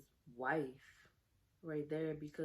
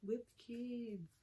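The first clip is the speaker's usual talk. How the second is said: hurt feelings; 3 semitones higher, 5 dB quieter than usual. Real, not acted. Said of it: "my little feelings was hurt".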